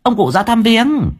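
high-pitched gossiping villager; speculating villager. A narrator's reading. Villager asking onlookers about the old man, high-pitched speculation. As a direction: venture a guess in a high voice.

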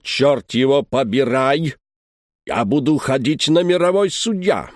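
slow, furious, broken Russian; German-accented angry rant. A narrator's reading. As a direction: growl it like a bulldog boss. Director furiously scolds the groom.

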